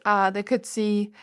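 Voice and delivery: normal talking voice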